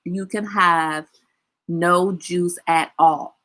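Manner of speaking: very slow, each word spelled out; drawn-out no juice at all; slow emphatic refusal. Shown as a demonstration. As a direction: lay down the law word by word. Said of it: "I need to say something like".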